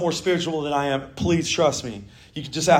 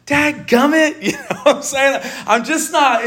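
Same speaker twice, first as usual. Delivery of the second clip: chuckling through the words; laughing; 7 semitones higher than usual. Real, not acted. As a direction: laugh while you say it. Laughing at own sin joke.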